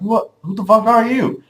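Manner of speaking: high-pitched, incredulous demand; acting out alarmed disbelief; startled challenge. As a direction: retell a startled confrontation, pitch jumping up. Confronting intruder in retold dream.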